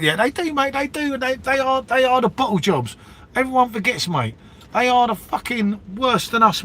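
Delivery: rising indignation at the rival club; worked-up rant. Real, not acted.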